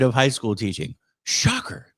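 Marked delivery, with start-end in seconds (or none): 1.2-1.9 sarcastic. Acted. Sarcastic reaction to obvious finding.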